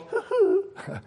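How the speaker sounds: tiny high-pitched fake sob; mock weeping. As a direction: whimper daintily, a soft little boo-hoo. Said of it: "He didn't just go".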